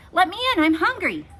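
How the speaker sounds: high, loud begging at the door; desperate knocking plea; pleading princess. A narrator's reading.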